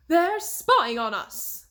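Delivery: alarmed gasp. A narrator's reading. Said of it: "the Queen of Hearts gasped".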